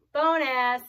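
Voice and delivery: ordinary delivery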